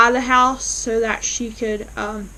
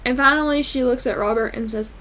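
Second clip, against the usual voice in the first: usual speaking voice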